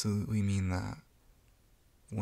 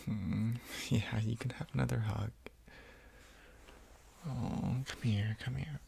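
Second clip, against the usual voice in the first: hugging whisper; 2 semitones higher, 5 dB quieter, slower than usual. Acted. Offering the roommate another hug, a tender whisper. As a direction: whisper into the hug, playful at the end.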